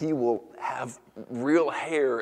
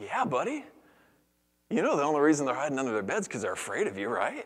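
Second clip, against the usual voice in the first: encouraging dad; 6 semitones higher, faster than usual. Retold or imitated. Dad confiding in his small son.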